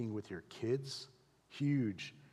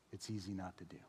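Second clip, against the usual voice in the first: softer and lower, fading; much softer; 6 semitones lower, 11 dB quieter, faster than usual. Shown as a demonstration. Pastor quietly naming the easy way out. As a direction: drop it almost to a hush. Contrasting doing and not doing.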